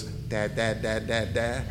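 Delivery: put-on soft, low baby babble of dad; baby babbling. Pastor imitating a toddler saying dad, soft babble. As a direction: coo it like a toddler's first word. Imitating a baby's first words.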